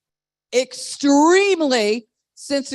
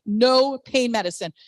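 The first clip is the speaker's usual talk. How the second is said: ordinary talk, nothing marked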